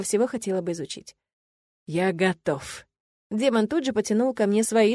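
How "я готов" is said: demon voice. A narrator's reading. Demon answers the witch, slow and lingering. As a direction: draw it out like a tempting demon. Demon eagerly agrees, teasing.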